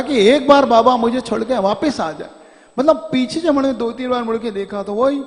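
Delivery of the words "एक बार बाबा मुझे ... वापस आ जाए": crying wish. Retold or imitated. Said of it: "रो रहा था".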